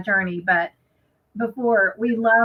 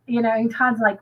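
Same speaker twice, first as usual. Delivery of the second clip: ordinary delivery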